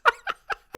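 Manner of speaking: squeaky burst of laughter; high-pitched laugh. Real, not acted. Laughing at own story.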